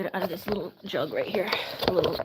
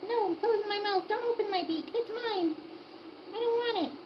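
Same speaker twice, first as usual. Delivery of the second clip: much higher squeaky pet voice, refusing; voicing the stubborn hen; 11 semitones higher than usual. Owner voicing the hen refusing medicine, high squeaky pet voice. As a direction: give the hen a high bratty voice. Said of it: "She's got, like".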